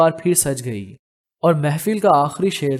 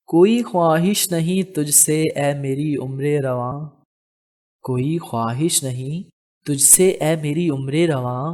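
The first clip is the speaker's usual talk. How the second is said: poetry recitation. Acted. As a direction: linger over each childhood memory. Shazmeen recites Noshi Gilani verse.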